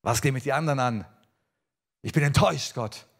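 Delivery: retold annoyed comeback, quick then punched; sour, sulky retort. Preacher acting out his sulky answer to God, sour. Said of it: "ich sagte immer noch sauer".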